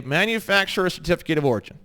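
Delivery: ordinary talk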